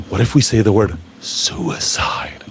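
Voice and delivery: mock-shocked whisper of a taboo word; hushed and aghast, as if it's forbidden; breathless scandalised whisper with a gasp. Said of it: "Oh my God, did you hear that?".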